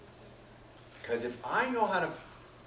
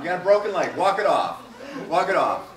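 gruff dad; 3 semitones higher, 9 dB louder than usual. Retold or imitated. Taryn's dad telling his hurt kid to toughen up, loud. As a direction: bark it like a no-nonsense father, laughing. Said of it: "Taryn, your dad said".

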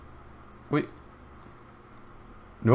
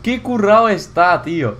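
high, loud, emphatic admiration; thrilled praise; 7 semitones higher, 3 dB louder than usual. Real, not acted. Player praising the shower build, high and excited. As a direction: gush with delight at the idea.

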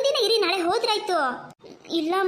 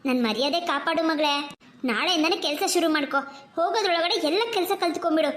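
scolding mother. Acted. Mother scolding her daughter about evening naps, nagging. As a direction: lecture the daughter, half pleading.

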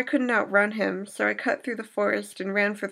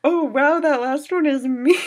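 loud, higher "oh wow"; surprised to find the choice hers; 6 semitones higher, 8 dB louder than usual. Real, not acted. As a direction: react with startled delight.

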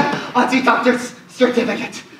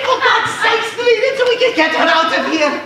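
much higher exasperated urging; exasperated plea; 7 semitones higher than usual. Acted. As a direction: beg the clerk in despair. Banker pleads exasperated with clerk.

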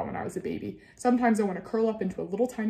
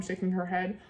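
plain reading voice

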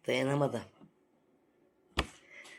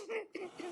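quick high burst of laughter; short high laugh; 17 dB quieter than usual. Real, not acted. Laughing at her own jab at the rival. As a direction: laugh at your own joke. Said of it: "beni güldürdün".

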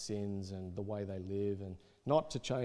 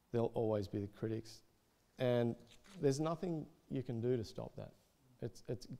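ordinary talk

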